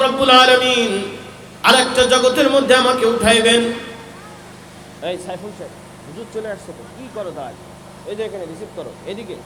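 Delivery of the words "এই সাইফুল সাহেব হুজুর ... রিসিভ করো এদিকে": much softer, off-microphone instruction; hushed aside. Real, not acted. Preacher tells a helper to receive the arriving guest, quietly. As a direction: drop out of preaching into quiet talk.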